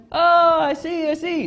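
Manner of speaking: high, loud put-on voice of a stranger suddenly getting it; lightly hammed stranger going "ah, got it"; mock German guy calming down. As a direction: play the angry man as he realises, with a fake non-German accent. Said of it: "And the guy went".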